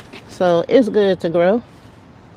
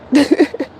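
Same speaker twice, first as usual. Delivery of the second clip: short, high chuckle; real chuckle; 7 semitones higher than usual. Real, not acted. Vlogger on birds seeking a snack, short chuckle. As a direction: chuckle briefly to yourself. Amused after the snack remark.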